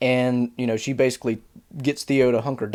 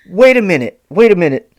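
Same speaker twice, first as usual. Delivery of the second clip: louder, higher excited double take; 4 semitones higher, 8 dB louder than usual. Retold or imitated. Reacting to the show naming Celeborn, with a loud excited double take. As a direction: catch yourself mid-thought, suddenly alert.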